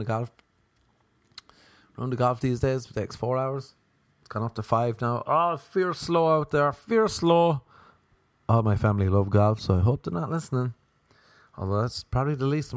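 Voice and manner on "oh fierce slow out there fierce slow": Irish golfer. Acted.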